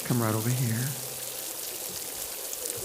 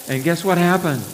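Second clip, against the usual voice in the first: ordinary delivery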